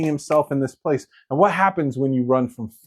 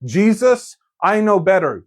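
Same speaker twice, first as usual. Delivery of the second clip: higher, louder know-it-all protest; insistent Peter; 7 semitones higher, 5 dB louder than usual. Retold or imitated. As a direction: push it harder the second time. Repeats Peter's protest, more heated.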